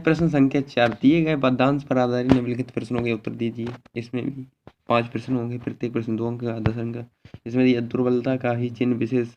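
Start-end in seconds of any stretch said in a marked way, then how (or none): none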